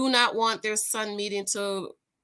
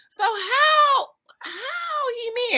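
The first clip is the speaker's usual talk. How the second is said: shocked disbelief; 13 semitones higher, slower than usual. Real, not acted. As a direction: cry out in disbelief. Incredulous again.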